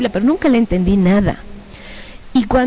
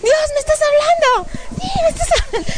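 high, breaking voice of joyful tears; much higher, weeping with gratitude; tearful joy. Retold or imitated. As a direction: cry it out, overwhelmed with joy. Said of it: "Empecé a llorar de alegría".

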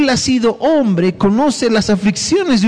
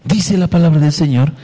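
ordinary talk

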